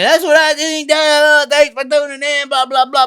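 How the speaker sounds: high, breezy show sign-off; mock sign-off. Imitating his own show outro, high and breezy. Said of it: "I usually say".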